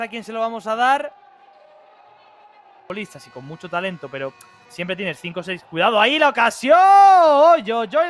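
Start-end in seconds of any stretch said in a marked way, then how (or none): 5.6-8.1 sudden excited shout; loud. Real, not acted. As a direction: yell the near miss. Near miss.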